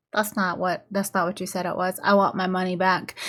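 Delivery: low, soft retold complaint; quiet objection. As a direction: object quietly, voicing the girl in the story. Girl's flat objection in retold scene.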